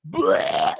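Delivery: sudden high retching noise; mock vomit sound, blurted out; hammed-up "bleh" of throwing up; imitated puking. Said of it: "It just started puking".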